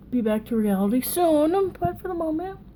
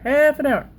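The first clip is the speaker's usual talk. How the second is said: half an hour, higher and louder; emphatic repeat; 6 semitones higher, 6 dB louder than usual. Real, not acted. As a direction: drive the point home the second time. Repeating the time limit to herself.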